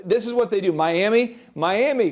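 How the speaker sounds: ordinary talk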